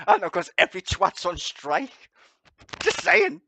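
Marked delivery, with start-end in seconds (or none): 2.8-3.4 sarcastic. Real, not acted. Sarcastic high aside.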